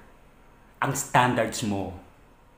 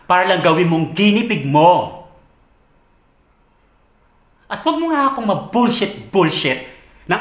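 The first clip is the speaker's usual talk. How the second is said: loud rebuke climbing higher; angry rebuke; 4 semitones higher, 5 dB louder than usual. Acted. Berating partner who used them, in angry rebuke. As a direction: lash out, indignant and raised.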